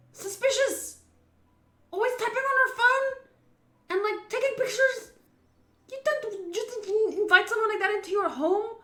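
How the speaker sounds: high, loud incredulous rant; can you believe she let her in; exasperated rant. Real, not acted. Ranting to chat about a suspicious woman, high and loud.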